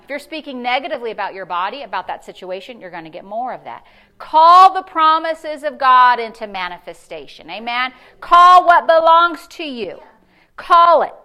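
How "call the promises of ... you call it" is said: rising sermon proclamation; fervent preaching. Acted. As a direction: proclaim it to the congregation. Preaching at full force.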